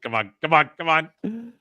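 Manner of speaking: urging button masher. Retold or imitated. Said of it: "It's like".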